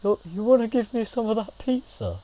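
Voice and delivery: high, mumbly imitation of friend; imitating friend's voice. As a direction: lightly mimic a pushy friend. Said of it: "and he was like".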